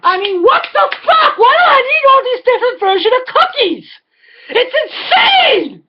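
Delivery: high, shouted disbelief; exasperated. Real, not acted. Comedian raging about too many cookie versions, exasperated shout. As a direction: throw your hands up and yell.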